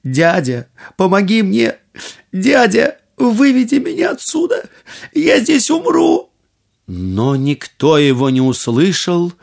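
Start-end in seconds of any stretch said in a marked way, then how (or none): 0.0-6.3 frantic rising cry for help; wailing higher and higher in panic; crying out in fear. A narrator's reading. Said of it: "громко заплакал и закричал".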